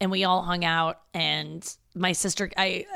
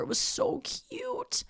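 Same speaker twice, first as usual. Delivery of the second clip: much higher, slow breathy squeal of cuteness; gushing whisper; 6 dB quieter, much slower than usual. Real, not acted. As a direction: melt over the cute moment in a whisper. Gushing over nephew hugging sister.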